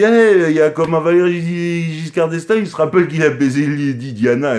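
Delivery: crié plus haut et plus fort, en provocation; il harangue le juge, voix montée; interpellation criée. Retold or imitated. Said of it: "Et comment je dis". (cri moqueur lancé au juge)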